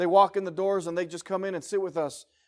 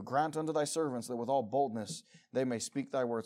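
softer, gentler reading of the plea; soft prayerful reading; 6 semitones lower, 7 dB quieter than usual. Shown as a demonstration. Preacher reading the plea for boldness again to the church, soft and humble. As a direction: murmur the verse like a humble prayer. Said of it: "same verse said loud before".

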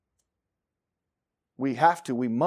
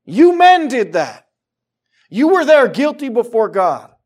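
loud, higher, accusing proclamation; accusing Peter; 7 semitones higher, 5 dB louder than usual. Retold or imitated. Peter confronting the men who crucified Jesus, loud accusation. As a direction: hurl the guilt back at them. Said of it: "Peter's standing there saying".

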